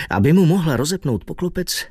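ordinary delivery

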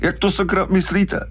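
very high, squeezed protest; strangled. A narrator's reading. Hooper called a liar while throttled, strangled. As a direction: protest indignantly through a gripped throat. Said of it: "Brody ho znovu popadl za krk".

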